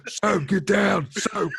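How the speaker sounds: high, drawn-out shout of Soap, get down; hammed-up battlefield call; mock game yell. Gamer acting out a warning shout to Soap. Imitating Call of Duty yelling.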